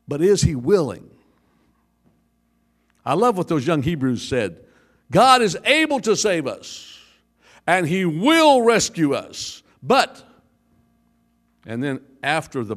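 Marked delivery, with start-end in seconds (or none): 5.1-10.2 bold declaration. Retold or imitated. Quoting young Hebrews boldly.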